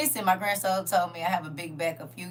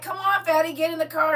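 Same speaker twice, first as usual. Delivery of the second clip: raised, higher calling voice; calling her child; 8 semitones higher than usual. Retold or imitated. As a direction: call the kid over to the car. Mother calls child at school pickup.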